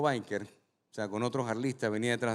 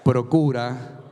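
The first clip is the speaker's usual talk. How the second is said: preaching at full force; 3 semitones lower, 7 dB louder than usual. Acted. Pastor has the congregation repeat to a neighbour, full preaching. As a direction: proclaim it so the back row hears.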